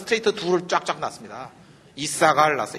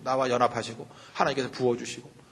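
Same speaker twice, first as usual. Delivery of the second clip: ordinary talk, nothing marked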